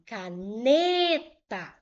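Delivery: "caneta" with NE long and loud; stress on NE. Shown as a demonstration. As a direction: lean hard on the middle of "caneta", stretching it. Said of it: "É a que eu falo com mais intensidade". ("caneta" com o NE forte e alongado)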